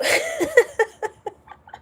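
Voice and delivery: high, loud burst of laughter; real laughing fit. Real, not acted. Streamer breaking up at own mom joke, high laughing fit. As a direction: crack up helplessly, high and loud.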